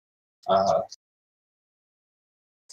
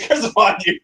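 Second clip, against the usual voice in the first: high theatrical spellcaster curse; dramatic curse; 13 semitones higher, faster than usual. Acted. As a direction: cast the curse like a villain. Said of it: "And I say".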